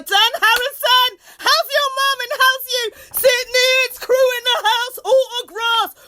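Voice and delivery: high, loud calling out names; excited shout-outs. Real, not acted. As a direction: hype up friends by name. Shout-outs to friends on stream.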